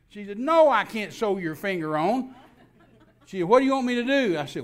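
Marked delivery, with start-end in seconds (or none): 0.4-2.3 loud, high, slow mock protest; exasperated refusal. Wife refuses, aghast, in acted quarrel. 3.5-4.4 high, loud, exasperated cry; mock frantic wife. Frantic wife in acted exchange.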